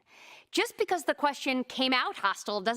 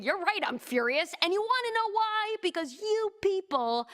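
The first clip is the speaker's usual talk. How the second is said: rising, heated retort that climbs higher; mock angry questioner; 8 semitones higher than usual. A hostile questioner firing back at the speaker, mock angry. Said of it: "the questioner responding".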